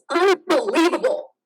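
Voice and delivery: loud, high burst of outrage; indignant outburst. Acted. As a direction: explode in disbelief. Outburst after bad news.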